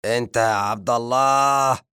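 loud angry summons; angry booming shout. Acted. The zombie shouting for the servant who won't answer, angry bellow. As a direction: bellow his name in anger. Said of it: "فصاح بغضب بصوت جهوري".

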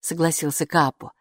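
ordinary delivery, nothing marked

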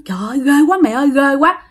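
imitated scared outcry, kept fairly contained; retold frightened cry. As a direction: act out a friend crying out in fright, lightly. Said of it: "nó la với bạn quá trời luôn".

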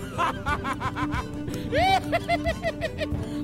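long, shrieking high laugh; staged high-pitched cackle. Acted. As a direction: peals of performed laughter. Staged laughter after slogan.

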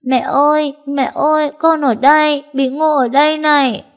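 put-on a small child bursting into tears at seeing mom; tearful little girl calling to her mother; little girl crying out. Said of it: "mếu máo khóc òa lên".

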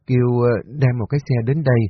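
plain reading voice

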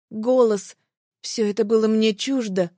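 ordinary delivery

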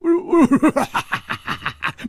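high, bursting guffaw; helpless laughter. A narrator's reading. Hu cannot help laughing at the old man, bursting out. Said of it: "胡铁花忍不住笑了".

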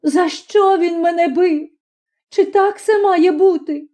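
high-pitched tearful questioning; plaintive high cry; sobbing. A narrator's reading. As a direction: demand an answer through sobs. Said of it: "хлипала вона".

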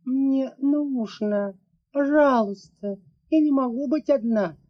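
daughter begging her father not to be alone; pleading little girl. A narrator's reading. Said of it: "умоляюще прошептала Ларисинья".

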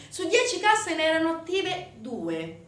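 ordinary talk, nothing marked

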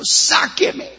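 sudden high cry for help; a drowning man yelling to be pulled out; high, rising, panicked cry of "Sáqueme"; desperate plea. Pretend. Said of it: "no diga desesperado".

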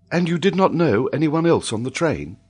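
high lady's voice asking; concerned lady. A narrator's reading. Lady questions the child.

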